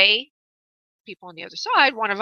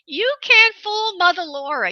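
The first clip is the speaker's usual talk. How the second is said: higher, slow, singsong tease; playful teasing; 9 semitones higher than usual. Real, not acted. Psychic teasing a caller who tried to test her, playful higher singsong. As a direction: wag a finger at the caller with a grin in the voice.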